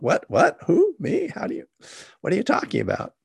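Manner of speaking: squeaky high, startled what-who-me; incredulous disbelief. Retold or imitated. The student recalling his shock at the teacher, incredulous. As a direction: play your own baffled reaction, pitch shooting up.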